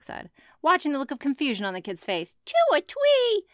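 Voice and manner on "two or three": put-on high squeaky little kid. A narrator's reading. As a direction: pitch way up like a toddler. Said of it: "the kids asked".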